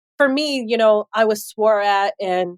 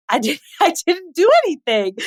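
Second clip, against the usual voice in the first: laughing protest; 7 semitones higher than usual. Retold or imitated. Retelling own protest, laughing.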